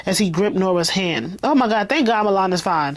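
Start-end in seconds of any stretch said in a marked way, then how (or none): none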